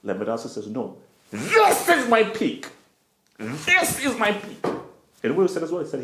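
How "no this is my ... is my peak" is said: high, slow, stretched-out insistence; insistent protest. Acted. Striker telling the fan this is his peak, stretched insistent protest. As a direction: draw out each word in hammed-up defiance. Player rejects the fan's plea.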